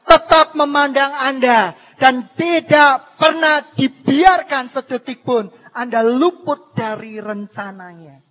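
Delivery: loud, emphatic pulpit proclamation; thundering preacher; full-force preaching. Real, not acted. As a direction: drive each phrase home loudly to the congregation.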